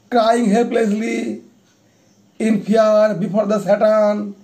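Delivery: solemn poetry reading with long pauses between phrases; poem recitation. Acted. Recites own anti-war poem.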